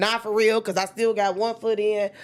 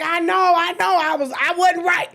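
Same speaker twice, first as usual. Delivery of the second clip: high, loud, squeaky I know, I know; sheepish admission; 10 semitones higher, 6 dB louder than usual. Retold or imitated. Conceding to her friend with a high sheepish squeal.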